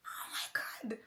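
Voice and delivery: awed whisper. Retold or imitated. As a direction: whisper it as a stunned gasp, acting him out. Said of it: "It was like".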